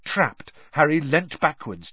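ordinary delivery

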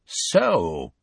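drawn-out, nasty "so"; nasty inflection. A narrator's reading. Centaur greeting an unwelcome human, nasty inflection. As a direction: say it with a nasty edge. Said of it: "with a nasty inflection in his voice".

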